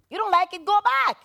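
loud mock yell; retold shout. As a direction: yell it at him. Retold angry shout at soldier.

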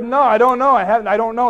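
loud, protesting mock denial; emphatic denial. Poet denies knowing the play to professor, loudly emphatic. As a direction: push back hard, acting out the argument.

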